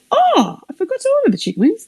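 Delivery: sharp gasp then a high, fast rush of words; delighted gasp, words tumbling out; gasp of surprise. Real, not acted. Gasping at the free chicken wings in the box.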